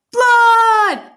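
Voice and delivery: high, loud drawn-out yell; full-voiced storybook scream. A narrator's reading. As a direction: shriek it in alarm, falling at the end. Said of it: "Loretta's mother yelled".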